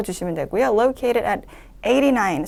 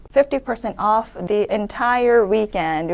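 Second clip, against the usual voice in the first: slow reading, the voice dropping at "weekend"; falling finish; much slower than usual. Shown as a demonstration. Teacher modelling a sentence ending, slow with a final fall. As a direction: read slowly and let it fall to close. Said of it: "마지막으로 내려 읽어가면서 마무리".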